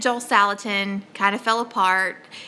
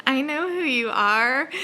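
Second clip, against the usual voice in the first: starstruck reply; 4 semitones higher, slower than usual. Retold or imitated. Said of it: "I'm like".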